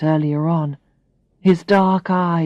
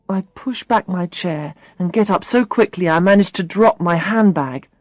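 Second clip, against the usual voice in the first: usual speaking voice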